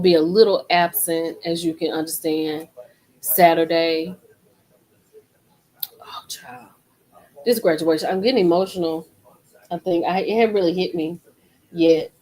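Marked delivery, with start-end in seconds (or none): 6.0-6.7 whisper. Real, not acted. Overwhelmed by graduation emotions.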